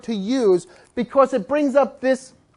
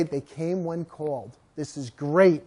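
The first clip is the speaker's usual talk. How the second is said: much lower, quiet, slow reassurance to oneself; calm self-talk; 7 semitones lower, 6 dB quieter, slower than usual. Retold or imitated. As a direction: breathe out and calm yourself. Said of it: "take a deep breath and say".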